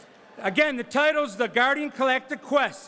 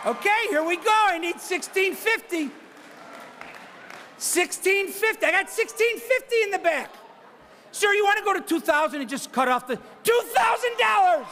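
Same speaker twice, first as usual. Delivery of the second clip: brisk auctioneer patter pushing for the next bid; auctioneer bid calling; 7 semitones higher than usual. Real, not acted. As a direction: call the bids like a charity auctioneer. Auctioneer running live bidding.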